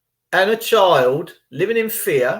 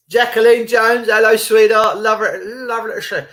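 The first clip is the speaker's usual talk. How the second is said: higher, louder happy greeting; cheery shout-out; 6 semitones higher, 4 dB louder than usual. Real, not acted. Vlogger greeting a viewer in chat, bright and loud. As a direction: beam out a hello to a fan.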